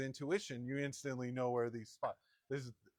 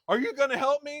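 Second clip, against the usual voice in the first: imitating wife, much higher and louder, demanding help; mock demanding yell; 12 semitones higher, 12 dB louder than usual. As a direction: snap the question like she's fed up.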